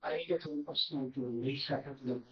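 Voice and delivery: soft, hard-to-hear delivery; voice not kept up. Real, not acted. Apologizing to the judge over a date, too quiet. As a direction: speak well under normal volume. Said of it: "You gotta keep your voice up".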